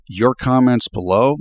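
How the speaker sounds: ordinary delivery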